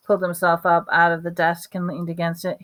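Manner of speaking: ordinary delivery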